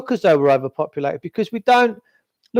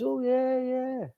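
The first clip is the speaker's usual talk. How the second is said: much softer, higher, limp "oh yeah yeah"; mock agreement; 5 semitones higher, 11 dB quieter, slower than usual. Mimicking everyone brushing him off, soft dismissive yeah. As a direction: mouth a dismissive brush-off, barely voiced. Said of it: "everyone goes".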